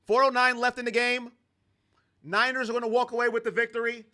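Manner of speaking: steady game-call delivery; light sportscaster narration; play-by-play call. Acted. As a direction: call the plays like a broadcaster.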